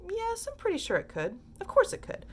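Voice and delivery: talking himself into it, soaring up; rising certainty. A narrator's reading. Wonka convincing himself, pitch climbing high. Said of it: "Wonka working himself up".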